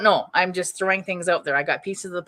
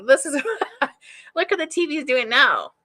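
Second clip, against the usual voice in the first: laughing; 8 semitones higher, 4 dB louder than usual. Retold or imitated. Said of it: "be like".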